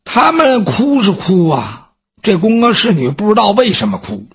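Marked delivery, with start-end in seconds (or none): none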